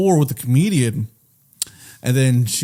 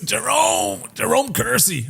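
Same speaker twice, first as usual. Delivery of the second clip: very high, softer silly voice; put-on disguise character; 9 semitones higher, 4 dB quieter, slower than usual. Disguised man giving his fake name, silly high voice. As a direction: pitch it way up, hammy.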